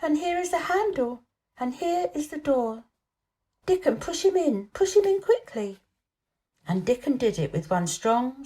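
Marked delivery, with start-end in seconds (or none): 0.0-5.8 high, eager hurried urging; girl at the secret door, excitedly urging; urgent excitement. A narrator's reading. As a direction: push them on, thrilled and impatient. Mary urging at the hidden door.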